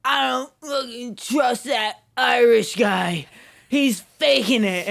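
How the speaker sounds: put-on drunk roommate. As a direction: drag it out like a wasted suspicious roommate. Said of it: "And he's like".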